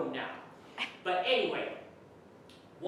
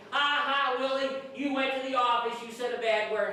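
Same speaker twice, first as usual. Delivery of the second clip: mock schoolyard taunt, louder and higher at first; mocking laughter; 5 semitones higher, 3 dB louder than usual. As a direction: jeer like a gloating kid with a put-on "ha ha". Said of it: "Monty Muckles was laughing at me".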